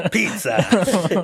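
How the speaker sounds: laughing mock Italian pizza; fake Italian accent. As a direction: say it like a cartoon Italian. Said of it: "that's exactly how they".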